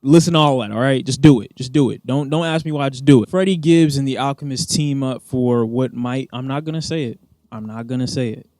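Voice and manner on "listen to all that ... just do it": loud, emphatic orders; insistent command. Real, not acted. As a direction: order them loudly, brooking no questions. Ordering viewers to listen.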